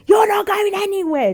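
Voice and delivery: loud high angry yell; loud high furious shout; harsh shout. Retold or imitated. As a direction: bark the threat at her. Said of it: "first time I heard him raise his voice".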